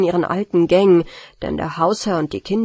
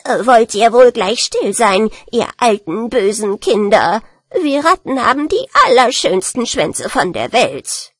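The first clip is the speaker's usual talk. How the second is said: angry rat scolding, louder; wütende Ratte; 2 semitones higher, 6 dB louder than usual. Acted. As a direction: scold the children as an indignant rat. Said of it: "lief sie zornig herbei und schrie wütend". (wütend keifende Ratte)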